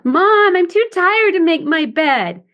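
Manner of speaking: put-on high, slow, whiny little girl; whining child. Shown as a demonstration. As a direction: whine to mom about the chore. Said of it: "whining in her adaptive child".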